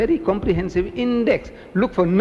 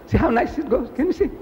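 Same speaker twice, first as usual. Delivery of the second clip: high, eager demonstrating voice; high-pitched, pleased little demonstration; showing off; 11 semitones higher than usual. Acted. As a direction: show off your neat eating in a high-pitched voice. Acting out showing off eating.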